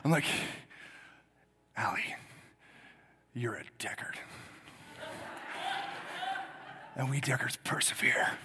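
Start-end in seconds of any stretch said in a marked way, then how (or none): 1.8-8.4 low, hushed, portentous pauses; solemnly impart the family creed, hammed-up; retold fatherly gravitas. Mock-solemn fatherly speech, audience laughs.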